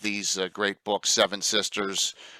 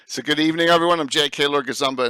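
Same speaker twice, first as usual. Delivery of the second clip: show host intro; 4 semitones higher, faster than usual. Acted.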